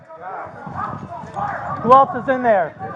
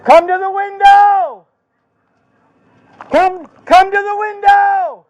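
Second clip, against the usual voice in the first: slow, loud urgent shouting; 6 semitones higher, 3 dB louder, slower than usual. Real, not acted. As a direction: yell it through the window. Trapped people behind window.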